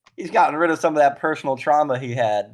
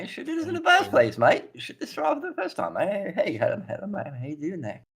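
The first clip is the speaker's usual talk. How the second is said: much higher, chirpy mate voice; high squeaky Cockney voice; 7 semitones higher, 3 dB quieter than usual. Acted. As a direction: greet them cheerfully up high. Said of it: "his accent changed".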